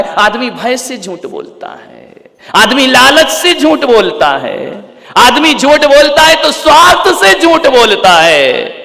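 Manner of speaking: building to a high, loud, drawn-out proclamation; slow, mounting sermon cry; impassioned preaching. Acted. Preaching at rising force.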